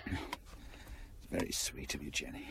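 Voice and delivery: soft whisper. Acted. Husband tender in hospital bed.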